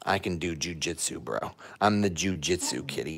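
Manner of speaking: low, soft tough-cat voice; voicing the cat. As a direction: give the kitty a low cocky voice. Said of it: "He's like".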